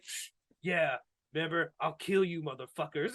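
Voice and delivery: very soft, low imitated threat; hushed mock-sinister warning; quiet menace. Fan playing the villain threatening his friends at lunch, quiet menace.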